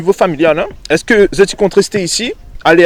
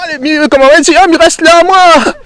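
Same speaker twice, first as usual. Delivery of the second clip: high, loud playful exclamation; hammed-up lovestruck protest, pitched way up; teasing mock outburst; 12 semitones higher, 7 dB louder than usual. Host teasing the one who stays for a Québécois sweetheart, mock outburst. Teasing the youngster about staying for love.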